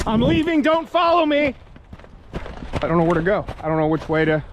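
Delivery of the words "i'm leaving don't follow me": shouting. Real, not acted. Yelling at the followers.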